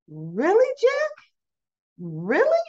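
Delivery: hammed-up disbelief, swooping steeply upward; [name], incredulous. [name] beside Jack, exaggerated incredulity. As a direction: let each question soar into a mock squeal.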